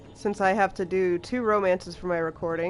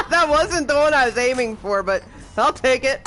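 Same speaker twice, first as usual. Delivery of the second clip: higher, louder gleeful remark; amused; 5 semitones higher, 5 dB louder than usual. Real, not acted. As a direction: still laughing, pleased with yourself. Amused after wrong target kill.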